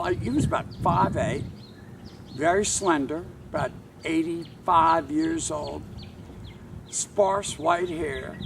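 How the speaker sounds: plain reading voice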